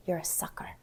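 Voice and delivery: soft, breathy "you're a sucker"; hushed scolding; quiet taunt. Real, not acted. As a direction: say it low like a jab. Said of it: "That's what I say".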